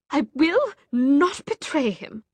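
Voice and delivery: high, loud, slow refusal; defiant refusal. Acted. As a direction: dig in your heels.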